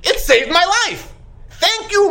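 high, elated thanks; happy gratitude. Acted. Grateful driver thanks his car's safety device, happily. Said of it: "he's so happy".

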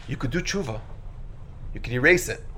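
soft, low-key suggestion; quiet retold advice. Someone telling another they could repent, quietly.

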